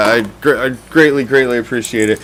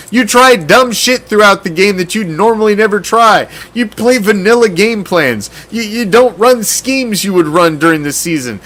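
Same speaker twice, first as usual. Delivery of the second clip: ordinary talk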